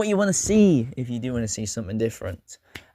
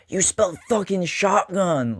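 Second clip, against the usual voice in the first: higher-pitched mock American outburst; exaggerated American accent, pitched up; 7 semitones higher than usual. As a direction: quote it indignantly in a put-on American accent. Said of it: "that was my American accent".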